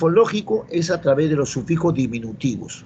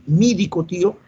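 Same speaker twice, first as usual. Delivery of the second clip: nephew's higher Andean Spanish, "médico" as "mídico"; Andean Spanish accent; 5 semitones higher, 3 dB louder than usual. Acted. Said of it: "ellos vienen con su castellano andino".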